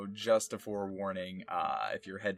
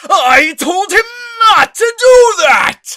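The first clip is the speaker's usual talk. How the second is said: booming overacted enraged yell; furious shouting; 21 dB louder, slower than usual. Shown as a demonstration. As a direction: rage like a Saiyan. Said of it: "And if he was angry".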